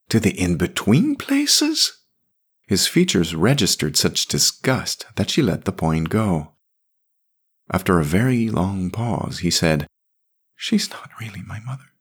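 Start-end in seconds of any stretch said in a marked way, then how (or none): 0.1-2.0 put-on high, slow boyish disgust; boy's disgust. A narrator's reading. Jamie, disgusted. 10.5-11.9 put-on whispered confession from a little boy. A narrator's reading. Boy confides secret.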